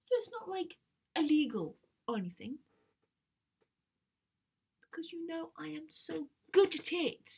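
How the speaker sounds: high, whiny protest building to an outburst; indignant teenage daughter. Acted. Teen girl fighting Dad over the car, indignant.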